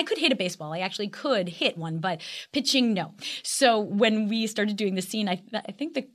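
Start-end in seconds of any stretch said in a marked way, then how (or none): none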